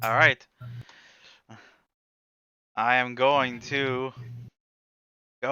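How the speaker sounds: normal talking voice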